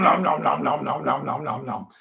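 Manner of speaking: chomp through the words like a worm eating paper; mock eating sounds that trail off; chomping noises. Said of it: "It's like, nom".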